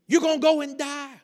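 hammed-up prayer-meeting panic; dramatic intercessors. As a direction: plead melodramatically, imitating them. Acts out intercessors' dramatic warnings.

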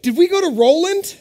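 high, loud mock sleep-talk question; sleepy wife. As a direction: squeak it out like a half-asleep wife. Sleepy wife voiced by husband.

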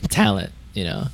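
a crack in the voice, low and soft. Real, not acted. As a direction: voice cracks on the word. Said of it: "Voice cracked a little there".

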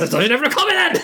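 high voice, laughing through it; high mock villain. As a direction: snap back in a squeaky pitch. Villain snapping back, voiced.

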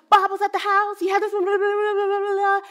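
high, breathless-style run-on babble; frantic report. Retold or imitated. Caller breaking news to the office, frantic mock babble. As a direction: rattle it off high on one pitch, mock panic.

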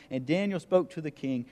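ordinary delivery

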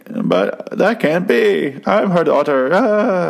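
high, drawn-out melodramatic wail; hammed-up disbelieving heroine, stretching the words; mock distraught. Acts out distraught daughter on phone.

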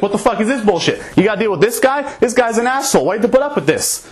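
high, heated outburst at the friend; a riled-up bar guy pushing for a fight; angry rant. Retold or imitated. Bearded guy berating Dave's friend, heated and high.

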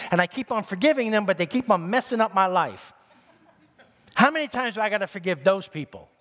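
high-pitched, worn-out griping; exasperated complaint. Acted. Preacher voices someone fed up with difficult people, exasperated. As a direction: complain as if they keep wearing you out.

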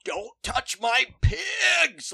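high, slow, loud protective yelp; mock shriek. Retold pig farmer shrieking at detectives to leave her pigs. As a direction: shriek it like she's guarding her babies.

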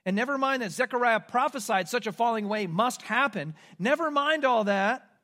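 ordinary talk

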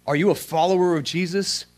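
normal talking voice

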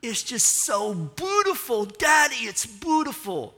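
put-on high, slow, loud child's voice full of wonder; breathless little daughter calling to her daddy; awed little girl. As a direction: sound like a tiny girl amazed by the sky. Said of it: "you hear little Iris looking up, going".

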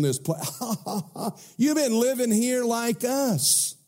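high, laughing scornful voice; mocking sons-in-law. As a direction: sneer and laugh at the old man.

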